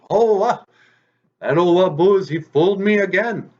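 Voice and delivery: loud, high cry of outrage; outraged fox. Acted. Fox just smacked on the head by rabbit, outraged. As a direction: wail it high and loud, tricked yet another time.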